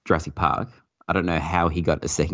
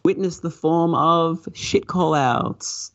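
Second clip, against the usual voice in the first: much higher, very slow theatrical villain voice; mock villain boom; 12 semitones higher, much slower than usual. Mocking bad pinball call-outs to the hosts, hammed up. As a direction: ham up a grand villain announcement. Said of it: "parody of villain call-out".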